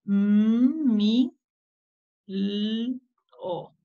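"M, mi, l, o" as stretched separate sounds; sounding out. Shown as a demonstration. Teacher reading a label with children, "M, mi, l, o" sound by sound. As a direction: blend "M" into "mi", hold "l", then a short "o". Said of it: "¿Qué letra tenemos aquí?".

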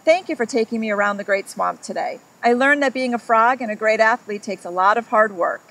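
lively read-aloud storytelling voice; story narration. A narrator's reading. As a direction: read it like a picture book to a child. Reads picture book to children.

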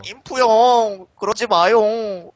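put-on high, slow and loud cutesy boy voice; drawn-out pleading in a sweet high voice; cutesy Deft voice. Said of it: "데프트 성대모사 해볼게요". (높고 느린 애교 섞인 목소리)

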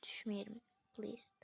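tense whispered plea not to fall; whispered prayer before a tricky jump. Real, not acted. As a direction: breathe it under your breath while jumping.

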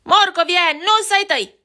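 high, loud bully yell; nasty rabbit screeching at Barney; bully shriek. Acted. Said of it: "țipă el".

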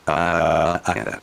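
ordinary delivery

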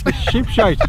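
high, playful mock Scottish "sheep shite"; jokey Scottish accent. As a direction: tease it out in a put-on Scottish accent. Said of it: "let me do it in the accent".

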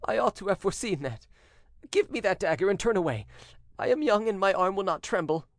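high-pitched, urgent insistence; resolute condemned son. A narrator's reading. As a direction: press your father with firm resolve. Son demands the dagger.

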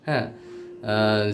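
normal talking voice

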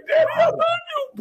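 put-on high crying-kid voice; child crying. Host imitating the crying son, high and squeaky. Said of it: "he started crying".

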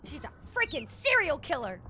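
loud, high hammed-up accusation; mock alarm. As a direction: warn everyone at full volume, playfully. Mock alarm about game lady.

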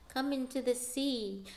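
usual speaking voice

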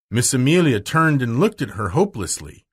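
ordinary delivery

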